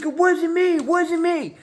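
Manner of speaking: very high whiny protest; mock high-pitched kid denying it; whiny denial. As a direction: plead innocence in a squeaky high voice. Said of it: "he's like".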